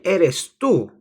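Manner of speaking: tú pushed high and loud; stress on tú. Shown as a demonstration. Teacher showing how to emphasize the pronoun, stress on tú. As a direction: hit tú hard, high and loud. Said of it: "So I'm emphasizing".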